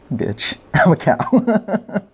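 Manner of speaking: slow, cracking up mid-line; can't keep a straight face reading it; laughing through lyric. Real, not acted.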